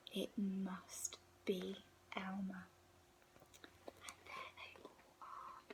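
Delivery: put-on soft secret whisper to the child. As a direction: hush it like sharing a secret. Storyteller's hushed aside to child.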